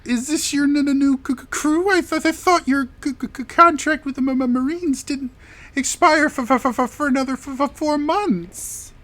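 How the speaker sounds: high falsetto questioning; curious daughter. Acted. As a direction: quiz the newcomers in a high-pitched character voice. Game master plays the daughter.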